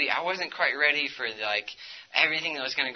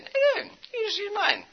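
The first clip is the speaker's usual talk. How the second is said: much higher, breezy buddy offering help; friend's high voice. Retold or imitated. Friend offering his flowchart, light high voice. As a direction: pitch way up like a casual friend tossing out an offer. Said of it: "my friend just, like, said".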